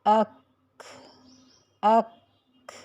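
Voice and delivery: اک with the ک closed, then released with a puff like کھ; breathy k release. Shown as a demonstration. Teacher demonstrating the aspirated ک in اک. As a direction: close on ک in اک and let air escape. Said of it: "کھا کی sound آئی".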